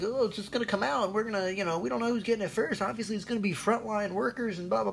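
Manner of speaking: high, squeaky mock voice of the authorities; high mocking imitation of clueless officials. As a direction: parody them in a silly voice. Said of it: "That's like".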